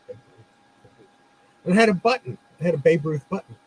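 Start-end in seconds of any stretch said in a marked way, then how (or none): 0.1-0.5 low murmur to himself, barely voiced; mumbling a name while thinking; quiet mutter. Shop owner murmuring the name while searching his stock, softly. 0.9-1.6 breathy whisper, almost silent. Retold or imitated. Shop owner whispering the name while searching his stock, whispered.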